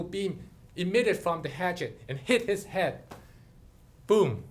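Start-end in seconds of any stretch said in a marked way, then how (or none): none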